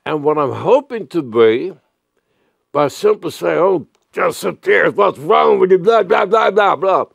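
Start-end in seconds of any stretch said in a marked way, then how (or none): 3.6-7.0 rising into a loud, high scold; hammed-up rant climbing in pitch; mock angry scolding. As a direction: build into a louder, higher harangue. Pastor acts angry scolding.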